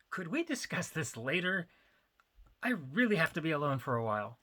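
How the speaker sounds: a pleading, pitiful whine; pathetic voice. Acted. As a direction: beg to be left alone, pathetically. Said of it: "in an honestly pathetic voice".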